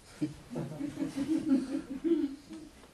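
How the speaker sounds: high-pitched delighted giggle; a quick giggle up high. Real, not acted. Narrator's high laugh.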